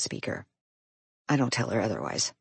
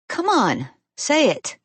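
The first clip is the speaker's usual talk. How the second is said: pleading; 11 semitones higher, 5 dB louder, slower than usual. A narrator's reading. Pleading playfully.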